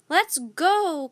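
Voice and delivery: loud urgent call; girl urging her brother in a storm; cried. A narrator's reading. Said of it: "cried Annie".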